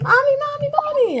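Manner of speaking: little daughter voice. Retold or imitated. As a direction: play the excited little girl running in. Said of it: "she's like".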